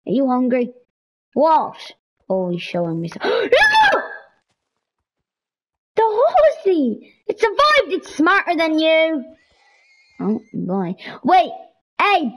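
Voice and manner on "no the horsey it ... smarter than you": high excited cry; 1 excited shout. Real, not acted. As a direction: burst out in alarm then joy. Horse in danger, then relief.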